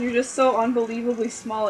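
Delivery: usual speaking voice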